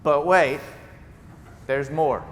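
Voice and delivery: loud infomercial pitch: but wait; hammed-up late-night TV pitchman; TV salesman voice. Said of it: "in my best T V salesman voice".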